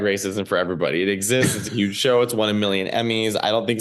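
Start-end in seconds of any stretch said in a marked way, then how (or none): none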